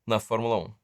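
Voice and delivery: ordinary talk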